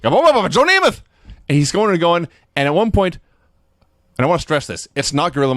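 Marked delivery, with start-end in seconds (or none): 0.0-1.0 high, loud, fast hammed-up babble; mock rant. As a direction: rattle it off like a manic announcer. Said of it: "Vince goes on some rant".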